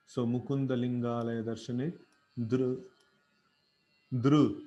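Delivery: "Dru" with the ru vowel brought out clearly; vocalic ri sound. Shown as a demonstration. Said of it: "So this is ru".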